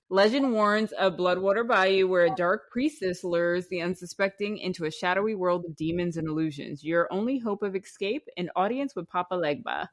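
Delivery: low, softened storyteller's read; blurb read-aloud. Acted. Host reading the Bloodwater Bayou legend to guests, hushed narration.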